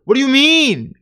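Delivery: loud, high-pitched outburst of disbelief; dumbfounded. Real, not acted. Host reacting to the claim about the accent, dumbfounded. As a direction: climb from exasperated bafflement to a high shout. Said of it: "I'm dumbfounded".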